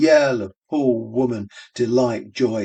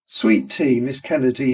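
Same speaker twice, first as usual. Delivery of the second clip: ordinary delivery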